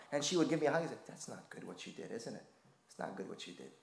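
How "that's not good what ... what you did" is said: very soft, low disappointed murmur; tender rebuke. Retold or imitated. Grandmother hugging grandson after mischief, soft low rebuke. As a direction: let her disappointment land softly.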